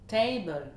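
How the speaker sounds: TA stressed in "Table", second syllable falls; first-syllable stress. Shown as a demonstration. Teacher models a common word, stress on TA in "Table". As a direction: hit the start: TA-ble. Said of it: "l'accentuation sur la première syllabe".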